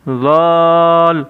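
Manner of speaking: ذال stretched slowly, thin and light; thin light letter. Shown as a demonstration. Teacher showing pupils a thin letter, drawn out. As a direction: draw out ذال lightly, no weight. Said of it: "باریک حروف".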